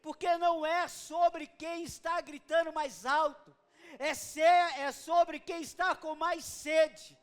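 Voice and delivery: loud, driving sermon delivery that keeps building; full-force preaching. Acted. Preacher rousing the church with a loud, building call. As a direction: hammer each line home to the congregation.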